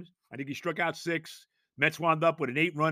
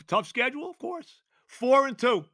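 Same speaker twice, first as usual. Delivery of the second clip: normal talking voice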